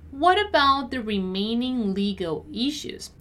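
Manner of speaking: measured, carefully enunciated read; deliberate teacher's model. Shown as a demonstration. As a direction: take it word by word for learners. Teacher models sentence slowly first.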